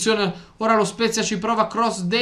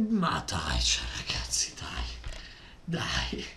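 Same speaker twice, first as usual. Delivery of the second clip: whispering; 15 dB quieter than usual. Real, not acted. Fan deflated after blunder.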